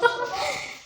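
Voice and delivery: echoing high laugh. Real, not acted. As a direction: laugh at your own echo. Laughs at the echo.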